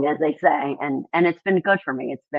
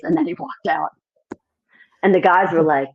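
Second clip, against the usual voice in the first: normal talking voice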